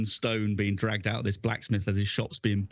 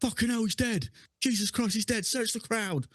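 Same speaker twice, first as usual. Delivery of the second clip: much higher, urgent shock; alarmed guard; 11 semitones higher than usual. Acted. Guard finds his dead comrade, alarmed and high. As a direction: yell it like you've just found a corpse. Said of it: "and go".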